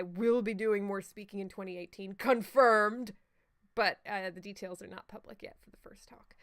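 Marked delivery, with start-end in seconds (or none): 4.3-6.3 hushed secretive whisper. Real, not acted. Secret about upcoming talk.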